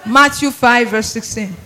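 ordinary delivery

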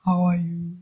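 low, subdued preacher impression; imitated preacher. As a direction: mimic a low-key preacher praying. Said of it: "preachers that speak like this".

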